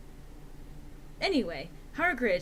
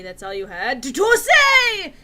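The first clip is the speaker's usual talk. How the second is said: outburst; 5 semitones higher, 14 dB louder than usual. Acted. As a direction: pitch and volume shoot way up on the end. Hagrid bursts out.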